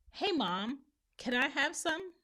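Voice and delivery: put-on high-pitched kid's request; child asking. Kid asking Mom for some oxtails, high and childlike.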